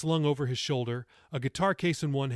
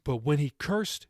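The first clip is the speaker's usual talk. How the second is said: usual speaking voice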